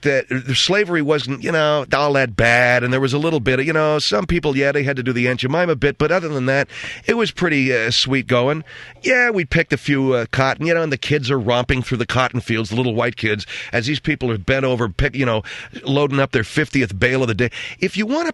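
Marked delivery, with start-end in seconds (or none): none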